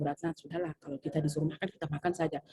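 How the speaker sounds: just eat it, resigned; resigned quiet. Retold or imitated. New teacher tells Bu Ratna to just eat it, quiet and resigned. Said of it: "saya bilang".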